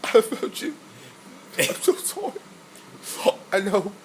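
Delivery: hammed-up tearful British butler; high, breaking mock-weep, soft and slow; Alfred. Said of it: "That was, like, the saddest scene".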